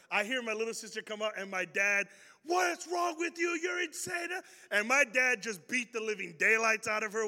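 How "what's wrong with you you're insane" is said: high, slow furious father shout; dad yelling. Retold or imitated. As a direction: rage at the daughter who threw shears.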